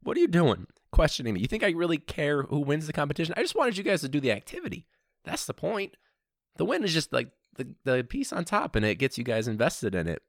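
high-pitched exasperated protest; mock-outraged teacher telling students off; indignant self-defence. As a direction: plead your case in comic exasperation. Scolding the questioning kids.

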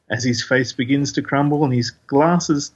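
ordinary talk, nothing marked

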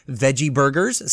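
ordinary delivery

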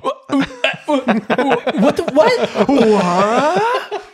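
high, slow, laughing "what"; stunned disbelief. Retold or imitated. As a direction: sputter in total shock. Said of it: "And he was like".

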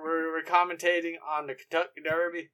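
put-on American southern twang; mock southern commentator; southern accent. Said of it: "let's switch to a southern accent".

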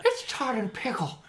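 high silly character voice; light high-pitched comic voice. Pretend. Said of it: "I'm gonna say".

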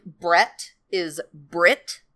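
Brett said very slowly as Brit, with a short i sound; New Zealand vowel. Shown as a demonstration. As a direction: stretch out "Brett is Brit", saying Brett like Brit. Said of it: "it's the way they say their schwas".

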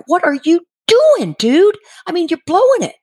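mock panic at a blown chance; exasperated. Wife behind the doctor urging husband, frantic exasperation.

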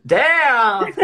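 loud high disappointed cry; mock dismay. Real, not acted. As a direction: exclaim in playful disbelief. Reacts to changed mind.

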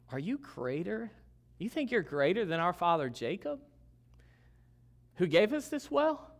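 incredulous challenge, pitch climbing at the end; bitter, sarcastic challenge. Retold or imitated. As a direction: throw it back as a skeptical question. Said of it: "Can you sense the bitter tone".

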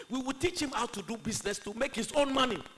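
very loud, high, emphatic father's ruling; preaching. Acted. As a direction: lay down the law from the pulpit.

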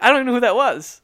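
dismissive aside. Retold or imitated. Seinfeld telling the interviewer he didn't know her. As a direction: brush it off to the interviewer. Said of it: "he's like".

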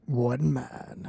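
low, soft trailer voice-over; lurid grindhouse trailer growl. Shown as a demonstration. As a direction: drop the low trailer tease. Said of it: "a movie phone or, like, a trailer".

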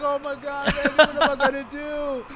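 high, loud mock despair; acted-out helpless fretting; retold panic. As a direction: wail it like you're panicking before a fight. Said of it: "a little panic anxiety attack".

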